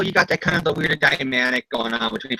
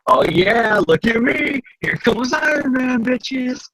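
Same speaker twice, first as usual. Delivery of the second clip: cocky Tony Stark; 9 semitones higher, much slower than usual. Retold or imitated. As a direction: brag it like a show-off. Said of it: "Tony's all like".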